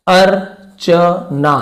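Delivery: Archana very slowly, in separated pieces; drawn-out dictation of Archana; syllable by syllable. Shown as a demonstration. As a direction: break Archana into अर, च, ना with pauses. Teacher sounding out the spelling.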